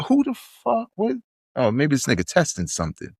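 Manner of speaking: high startled who the fuck; surprised. Retold or imitated. Shopper caught off guard by the track: high startled question. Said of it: "I'm like".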